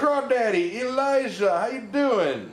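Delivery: bright, high calling-out hello; cheery greeting. Real, not acted. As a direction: call out a happy hello to a viewer. Greeting a viewer by name.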